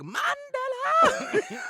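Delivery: long, very high, loud stretched shout of the name; Chris Tucker. Retold or imitated. Quoting the Rush Hour dice scene to his friend, stretched high laughing yell. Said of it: "I think of Chris Tucker in, um, Rush Hour".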